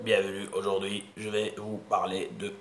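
low, slow, droning voice; listless monotone presenter; dull mumbling speaker. Shown as a demonstration. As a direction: drone on like a bored speaker. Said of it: "تصنت لواحد غير خمسة دقايق بحال هكا".

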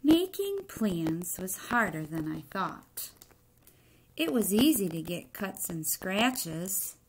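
put-on expressive read-aloud to children; story reading. A narrator's reading. Teacher reading a picture book to the class, expressive narration. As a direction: tell the egg's story to the class, lightly dramatic.

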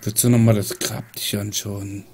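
ordinary talk

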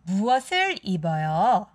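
ordinary delivery

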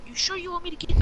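ordinary talk

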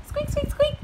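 very high little squeaks; high-pitched storybook mouse. Acted. Voices the mouse.

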